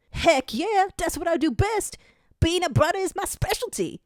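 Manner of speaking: high, excited buddy voice; an eager sidekick hamming it up; gruff brother character. Acted. Willie boasting to Zero, in a high eager voice.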